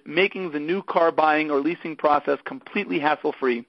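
brisk upbeat radio ad read; sponsor ad read. Acted. Podcast host reading a car leasing sponsor message, upbeat ad voice. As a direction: sell the leasing company like a radio spot.

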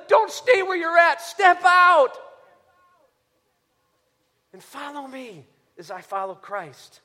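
rising from pleading to a loud shouted urging; voicing Paul's plea. Preacher voicing Paul urging the church, building to a shout. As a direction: plead like Paul calling them to step out.

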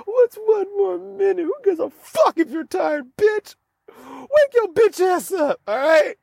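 high, strained drill-sergeant berating; bully yourself awake; high mock coach screaming at himself; harsh self-pep talk. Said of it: "this is how I talk to myself".

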